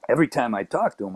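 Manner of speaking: normal talking voice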